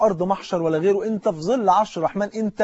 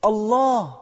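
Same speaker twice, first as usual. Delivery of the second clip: long drawn-out, higher, distant call; voice from far away; 6 semitones higher, much slower than usual. Retold or imitated. Dying man in the burning car, voice coming from far away. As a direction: stretch it out like a call from afar. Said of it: "فقال بصوت بقى جاي من بعيد".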